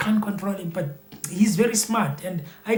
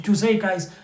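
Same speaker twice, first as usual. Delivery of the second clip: usual speaking voice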